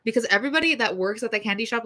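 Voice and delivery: usual speaking voice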